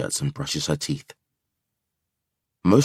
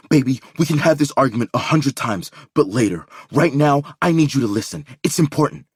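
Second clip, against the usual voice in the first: urgent plea; 6 semitones higher, 4 dB louder than usual. A narrator's reading. Urgent pleading.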